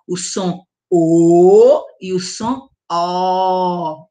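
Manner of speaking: slow contrast, first "O" closed and round, second "O" open; closed then open O. Shown as a demonstration. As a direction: set the two O sounds side by side, slowly. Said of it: "tem dois sons".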